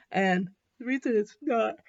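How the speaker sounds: words pulled long through a yawn. Real, not acted. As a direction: say it mid-yawn, jaw open. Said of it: "sorry for yawning".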